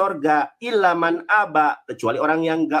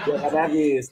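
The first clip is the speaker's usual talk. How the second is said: words through a chuckle; laughing taunt at the opponent; laughing tease. Real, not acted. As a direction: giggle the jab out. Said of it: "Enggak lucu juga ya".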